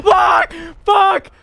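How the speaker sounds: very high, loud screamed curse; explosive high shriek; panicked swearing. Real, not acted. Fleeing zombies in game.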